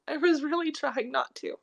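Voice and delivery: ordinary talk, nothing marked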